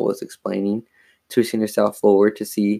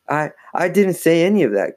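higher-pitched flustered protest; stammering, pleading higher voice; stammered; 7 semitones higher than usual. A narrator's reading. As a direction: stumble into a defensive denial. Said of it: "Chris stammered".

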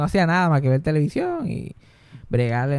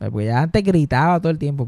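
ordinary delivery

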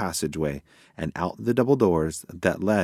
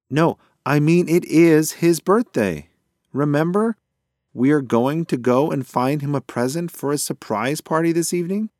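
raised, emphatic explaining; exasperated insistence; 7 semitones higher, 5 dB louder than usual. A narrator's reading. Mouse reminds pigeon of the surprise party, insistent and exasperated. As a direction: spell it out to a muddled friend, higher voice.

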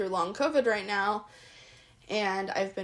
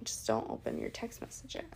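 whispering; 12 semitones lower, 14 dB quieter than usual. Real, not acted. Secret instruction, whispered.

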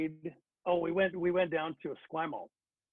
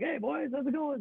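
cheery greeting; 13 semitones higher than usual. Retold or imitated. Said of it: "and I'm like".

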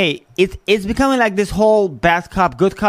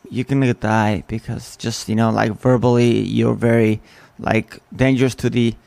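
a lower, ominous mock warning to the public; mock sinister voice; 6 semitones lower than usual. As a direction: sneer it like an ominous official. Mocking the media's threat.

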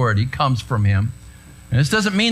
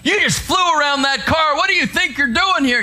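irate cop; 12 semitones higher than usual. Retold or imitated. Pastor voicing the angry officer at his window. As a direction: bark at the driver like a mad cop.

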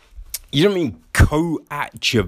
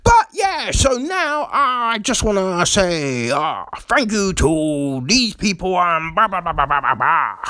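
suddenly fine speechmaker; 4 semitones higher than usual. Acted. Mocking an actor dropping the tears instantly, breezy and overblown. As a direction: switch abruptly into cheery, hammed-up speechifying. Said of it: "But then it's just like".

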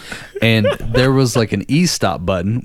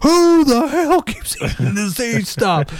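very high, drawn-out mock scream; slow, high-pitched imitation of a furious boss; imitated screaming. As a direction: scream it like an enraged supervisor. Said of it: "screaming to the top".

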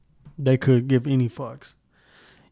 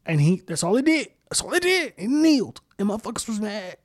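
high-pitched, incredulous venting; exasperated disbelief; 7 semitones higher than usual. Real, not acted. Venting about people mad over kneeling, exasperated. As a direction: climb high, baffled and fed up.

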